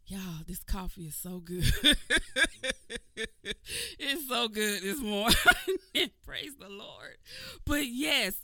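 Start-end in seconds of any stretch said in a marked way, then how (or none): 1.7-3.9 giddy laugh. Real, not acted. Laughing about good coffee. 5.4-6.2 high giddy laugh. Real, not acted. Laughing about good coffee.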